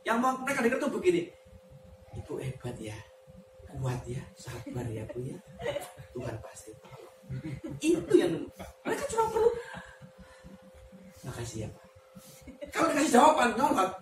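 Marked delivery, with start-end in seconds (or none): 2.1-7.2 much lower and softer, tender reassurance; soothing praise. Retold or imitated. A pastor comforting a hurt wife, much lower and softer. Soothing words counselees want. 11.3-11.9 much lower and softer grateful thanks. Retold or imitated. A comforted wife thanking the pastor, much softer.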